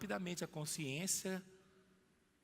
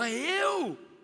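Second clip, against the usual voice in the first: surprised; 11 semitones higher, 9 dB louder than usual. Acted. Pedro's startled reply.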